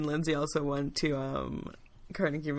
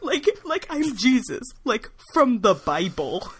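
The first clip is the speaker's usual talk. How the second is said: higher, affronted whine; indignant Jesus; 7 semitones higher, 4 dB louder than usual. Acted. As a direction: keep up the offended Jesus. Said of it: "Jesus was really pissed".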